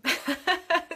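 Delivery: high, loud laugh; burst of laughter. Real, not acted. Laughing after telling the medium she is one, loud high laugh. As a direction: crack up at her own joke. Laughing at her own punchline.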